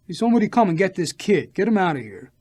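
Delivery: loud, high angry yell; screaming. A narrator's reading. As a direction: shout for someone to remove the kid. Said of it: "he screamed".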